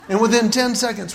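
normal talking voice

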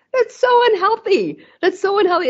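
high, loud emphatic repeat; emphatic self-critique. Real, not acted. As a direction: stress it like a warning.

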